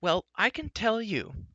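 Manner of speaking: very high, slow retort; high-pitched, drawn-out indignation; indignant shriek. A narrator's reading. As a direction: protest in a high outraged voice. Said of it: "shrieked Eilonwy".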